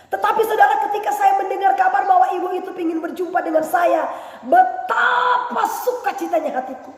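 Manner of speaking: high, loud, laughing exultation; laughing, exultant sermon shout; joyful preaching. Acted. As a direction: burst out with joy.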